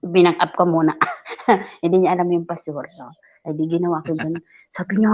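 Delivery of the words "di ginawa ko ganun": ordinary talk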